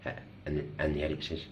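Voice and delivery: usual speaking voice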